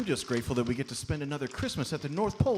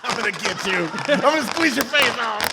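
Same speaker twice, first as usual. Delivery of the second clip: higher, louder teasing growl-free threat; higher playful menace; playful mock threat; 8 semitones higher, 7 dB louder than usual. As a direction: tease while squeezing.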